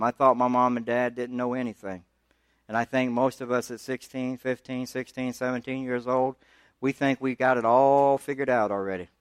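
usual speaking voice

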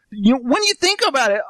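normal talking voice

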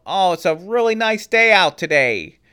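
high, loud, slowed shout of a man who can't hear; loud half-deaf boss. Retold or imitated. Imitating the half-deaf boss Gordon Cole, loud and high. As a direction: bellow it like someone going deaf. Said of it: "even about anything".

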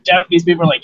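ordinary delivery

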